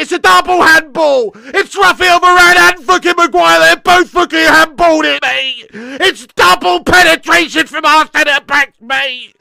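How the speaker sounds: loud, angry commentary tirade; exasperated rant. Real, not acted. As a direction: rail at your hopeless defenders. Ranting at defenders' double handball.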